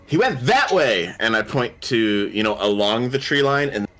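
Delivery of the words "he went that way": higher, slow mock shout; acted shout. Player sending guards toward the tree line, mock shout. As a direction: call out the false lead, drawn out and raised in pitch. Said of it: "and I shout".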